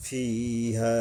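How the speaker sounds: ফিহা chanted with the vowel held; recitation. Shown as a demonstration. Teacher recites ফিহা as in tajweed, chanted.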